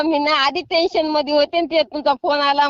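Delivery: ordinary delivery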